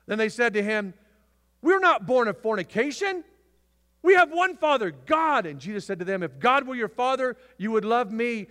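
loud, high, fiery sermon delivery; preaching at full force. Acted. Preacher roaring scripture at the church. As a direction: thunder it out to the congregation.

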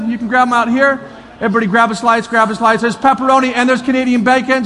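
ordinary delivery

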